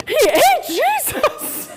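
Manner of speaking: high, slow, shocked shout with a laugh in it; a child's horror at what the priest just did; horrified cry. Retold or imitated. Son telling mom the priest ate Jesus, horrified shout. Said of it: "he was horrified".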